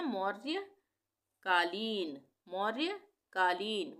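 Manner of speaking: मौर्यकालीन split slowly as मौर्य-का-ली-न; broken into parts. Shown as a demonstration. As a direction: break मौर्यकालीन into syllables. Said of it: "अलग-अलग तोड़ के पढ़ सकते हैं". (मौर्यकालीन तोड़-तोड़ के)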